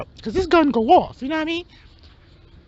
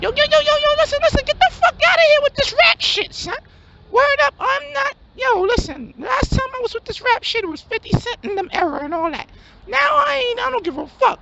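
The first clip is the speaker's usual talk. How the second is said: hustler friend; 6 semitones higher than usual. Acted. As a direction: shoot it up high in disbelief. High-voiced hustler rejects rap.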